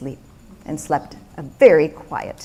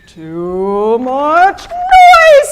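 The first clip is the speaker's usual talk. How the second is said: high, very slow, louder whine; exasperated Peter; 7 semitones higher, 6 dB louder, much slower than usual. Acted. Peter complains of the noise, very slow and whiny. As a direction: stretch every word in exasperation.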